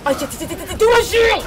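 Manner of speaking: rising to a high shout; building from startled curse to high shout; panicked yell. Real, not acted. Panic in fight.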